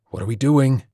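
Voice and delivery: plain reading voice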